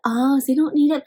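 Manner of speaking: plain reading voice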